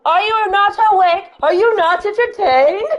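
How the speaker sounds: loud, high theatrical challenge; hammed-up shout. Waking the sleeper with a film quote, hammed-up shout. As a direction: bellow it like a mock gladiator.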